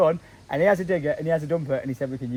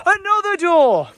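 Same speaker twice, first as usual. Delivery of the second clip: mock amazement; 11 semitones higher, 7 dB louder, slower than usual. Real, not acted. Echoing friend's discovery.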